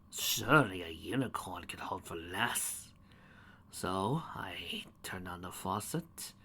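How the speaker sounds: slow, sinister hush; creepy whisper. Acted. Storyteller whispering the dark deed, slow whisper. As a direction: whisper it like a confession.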